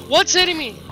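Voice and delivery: sudden high, loud alarmed question; startled yelp of a question. Real, not acted. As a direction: jolt of surprise. Attacked unseen in game.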